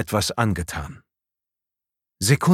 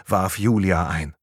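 usual speaking voice

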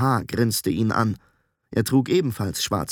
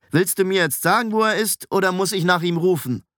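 higher, impatient challenge; challenging demand; 7 semitones higher than usual. A narrator's reading. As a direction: press him for an answer.

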